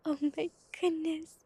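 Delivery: breathy burst of delight, loud and quick; sudden loud excited whisper; excited gasp. Real, not acted. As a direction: gush with real excitement. Excited reaction to viewer news.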